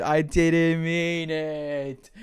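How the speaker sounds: very slow, stretched pleading; drawn-out apology. Real, not acted. Streamer pleading with chat, slow drawn-out. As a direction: drag every word out.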